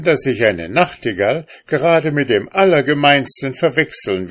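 laut und höher, empört; gekränkt heftig. A narrator's reading. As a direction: beleidigt auftrumpfen. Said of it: "wurde schon etwas heftig". (Mann verteidigt gekränkt sein Wissen)

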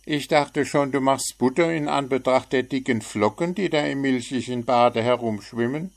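a chirpy bird poking fun; cheeky little bird. A narrator's reading. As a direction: frecher kleiner Vogel. Bird teasing.

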